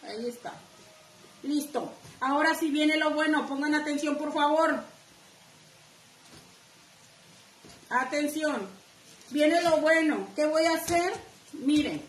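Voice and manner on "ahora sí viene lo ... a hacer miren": excited announcement. Real, not acted. The craft teacher tells her viewers to pay attention, loud and excited. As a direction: call their attention like a showhost.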